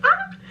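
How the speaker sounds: sudden very high excited squeal; short fangirl scream; high delighted shriek. Real, not acted. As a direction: let out a giddy shriek. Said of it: "I'm like".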